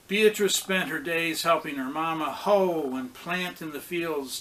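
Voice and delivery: ordinary delivery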